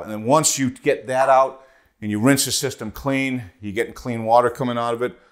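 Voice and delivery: usual speaking voice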